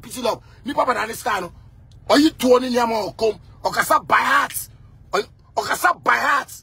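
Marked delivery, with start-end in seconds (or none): none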